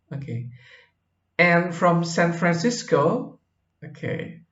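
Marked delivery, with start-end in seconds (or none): 1.4-3.4 "And from San Francisco" slow, high, rising at the end; rising question intonation. Shown as a demonstration. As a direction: ask it slowly, lifting "San Francisco" upward. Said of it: "Question itu nadanya selalu naik". (nada naik di akhir "San Francisco")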